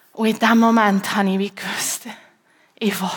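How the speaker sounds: tearful whisper; real crying. Real, not acted. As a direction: voice breaking into a whispered cry. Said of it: "meine Stimme hat sich aber verändert".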